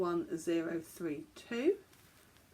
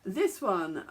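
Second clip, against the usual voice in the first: ordinary talk, nothing marked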